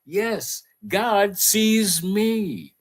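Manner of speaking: slow, high-pitched self-affirmation; awed declaration. Retold or imitated. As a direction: savour each word with wonder. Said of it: "look in the mirror and like".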